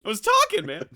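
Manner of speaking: very high aggrieved whine; hammed-up wounded protest; mock whining. Voice actor complaining he was talking, high mock whine.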